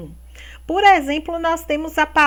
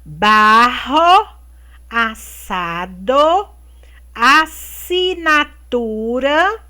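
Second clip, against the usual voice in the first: slow word reading; 5 dB louder, slower than usual. Shown as a demonstration. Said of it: "vocês vão separar as sílabas".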